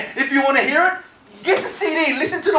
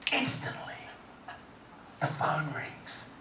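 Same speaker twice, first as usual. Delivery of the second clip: softer grave testimony voice, half whispered; hushed testimony; 9 semitones lower, 21 dB quieter than usual. Real, not acted. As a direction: draw them into the darkest moment, quietly. Hushed telling of suicide attempt.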